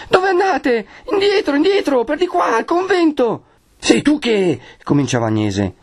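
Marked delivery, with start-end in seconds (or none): 0.1-3.4 put-on very high, panicked boy's voice; frightened, half-faint. A narrator's reading. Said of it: "ancor tutto tremante con voce mezza fioca". 3.8-4.6 startled questioning. A narrator's reading. Agnese starts questioning the boy.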